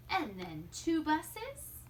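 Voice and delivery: plain reading voice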